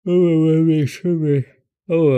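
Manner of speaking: words stretched through a loud yawn. Real, not acted. Apologizing to viewers mid-yawn, words swallowed by a yawn. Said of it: "I was yawning".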